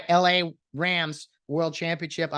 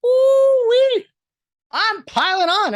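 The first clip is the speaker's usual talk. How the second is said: gleeful whoop; 9 dB louder, much slower than usual. Real, not acted. Gloating excitement over rivals.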